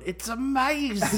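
high, drawn-out imitation of bad British; mock British accent. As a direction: mimic a friend's horrible British accent. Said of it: "Then he kept going".

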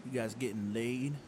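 a slightly sleazy DJ prying into private business. Acted. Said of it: "sleazy redneck D J voice".

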